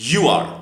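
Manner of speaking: "you are" as one loud word, you're; short form of "you are". Shown as a demonstration. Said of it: "Repeat after me".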